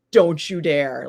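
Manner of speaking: retold threat at full voice; firm warning. Staring down the rival with the golden brick, firm warning. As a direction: warn him off firmly and openly. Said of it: "I literally stared him down".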